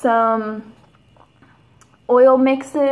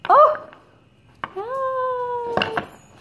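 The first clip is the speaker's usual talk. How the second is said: ecstatic squeal; 11 semitones higher than usual. Real, not acted. Gushing over the artwork.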